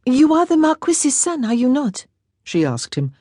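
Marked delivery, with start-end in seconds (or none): 0.1-2.1 put-on light, higher girlish voice; a lightly voiced young lady, wistful; young Frenchwoman, a bit higher and brighter; young French girl. A narrator's reading. Girl asks him.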